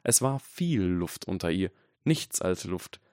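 ordinary delivery, nothing marked

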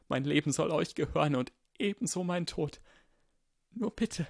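high-pitched, imploring servant voice; desperate pleading. Acted. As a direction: beg your lord, voice pitched high. Supplie son seigneur à genoux.

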